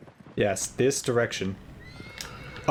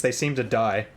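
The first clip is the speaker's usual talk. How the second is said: normal talking voice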